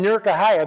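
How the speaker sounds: Newark Ohio run together as "Nerk-uh-HIGH-uh"; local pronunciation. Shown as a demonstration. Speaker shows audience how locals say Newark, Ohio, local pronunciation. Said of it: "That's how you say it".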